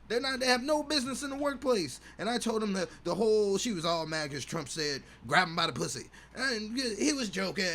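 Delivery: bitter divorced dad. Acted. Voicing a bitter MAGA dad.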